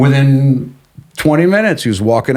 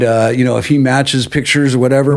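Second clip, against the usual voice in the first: usual speaking voice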